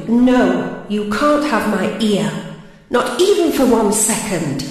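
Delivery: slow, measured recital of a poem; dramatic poem recital. Acted. Poet addressing Desire, reciting slowly and deliberately. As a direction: declaim each line to Desire, pausing between them.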